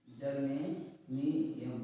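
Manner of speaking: Germanium drawn out for students; drawn-out teacher voice. Shown as a demonstration. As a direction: stretch germanium very slowly. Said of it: "क्या हो जाएगा?".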